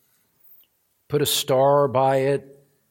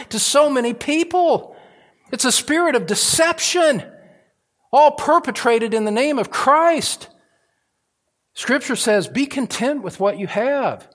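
loud, high fervent sermon climb; preaching at full force; 10 semitones higher, 6 dB louder than usual. Acted. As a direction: thunder the promise from the pulpit. Preacher denounces false teachers.

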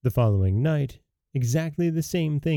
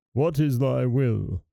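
slow, solemn genie voice; a lightly voiced servant spirit; slower than usual. Genie answers the lamp rub.

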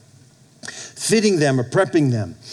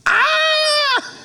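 sudden high yelp of pain; child's cry of pain. Retold or imitated. Pastor imitating his son's yelp as the bone is set. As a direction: cry out like a hurt toddler.

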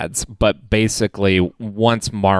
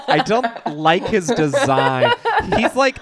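laughing; 9 semitones higher than usual. Real, not acted. Laughing while mocking a character design.

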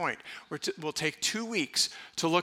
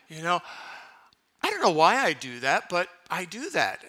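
ordinary talk

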